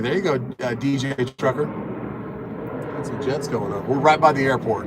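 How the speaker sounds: ordinary talk, nothing marked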